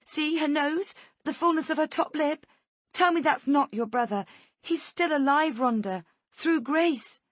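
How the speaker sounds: mother pleads desperately with the kidnapper; desperate babble. Acted. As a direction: beg her to see the resemblance. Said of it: "I begin to babble desperately".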